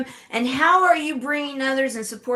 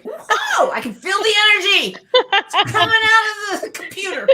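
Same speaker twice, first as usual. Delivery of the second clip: high, loud, laughing and thrilled; excited laughing gush; 9 semitones higher, 6 dB louder than usual. Real, not acted. Cohost gushing over the guest's jump photo, excited laughing. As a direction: gush with delight, laughing through it.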